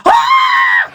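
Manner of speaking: piercing, very high scream; little-girl scream. Retold or imitated. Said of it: "scream in your ear like a little girl".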